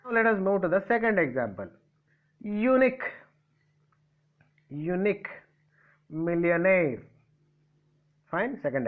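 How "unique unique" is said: "unique" with weight on "nique"; stress on last syllable. Shown as a demonstration. Teacher giving the second example, "unique" stressed on the end. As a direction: lean on the second half of "unique". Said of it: "Where did I keep the stress mark?".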